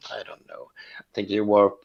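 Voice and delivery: usual speaking voice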